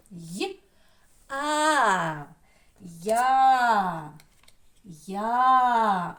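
short Й, long loud А, then drawn-out Я; sounds separated, then blended. Shown as a demonstration. Teacher breaks down the first sound, Й, А, Я held. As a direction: build a long Я from Й and А. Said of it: "Перший звук такий же йотований".

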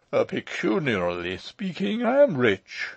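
put-on mildly cheerful old man, rising high and loud. A narrator's reading. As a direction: announce it with good cheer. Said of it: "returned the old man with cheerfulness".

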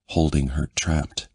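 usual speaking voice

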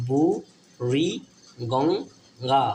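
slow, separated syllables with clear pauses. Shown as a demonstration. Teacher breaking a river's name down for pupils, syllable by syllable. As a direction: sound out বু, রি, Ganga piece by piece.

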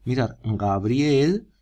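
Gabriel said very slowly and clearly; drawn-out model word. Shown as a demonstration. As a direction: stretch out Gabriel slowly for the class. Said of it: "vamos a ver Gabriel".

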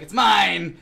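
a loud mock grab, It's mine; hammed-up possessive snatch; mock possessive queen. Retelling the show to friends, mock possessive queen.

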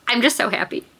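happy but close to crying; teary happiness. Real, not acted. Vlogger overcome by a sweet story, teary laugh. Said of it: "extra emotional right now".

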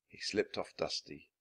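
plain reading voice

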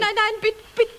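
ordinary talk

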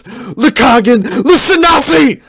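high, loud, slow sung war scream. Acted. Enraged knight screams a war cry at Randy. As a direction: bellow it like a battle chant. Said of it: "clenches his sword and screams".

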